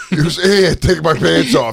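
high, loud teasing imitation; mock a pal's frantic offer; mocking Lou. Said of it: "I don't sound like that".

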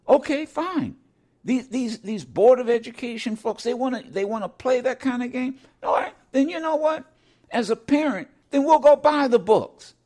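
fired-up retort. Retold or imitated. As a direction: pick up speed, challenge them. Retold defiant parent rant.

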